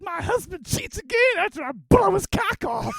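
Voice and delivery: high, loud laughing threat; gleeful revenge threat shouted high; a wife cackling as she vows payback; acted wife quote. Quoting a wife in a show.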